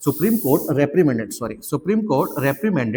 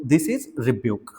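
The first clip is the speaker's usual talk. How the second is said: slow and deliberate; 3 semitones lower, much slower than usual. Shown as a demonstration. Teacher giving students the word, slow dictation. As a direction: spell out "rebuke" slowly for dictation. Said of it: "slow model sentence".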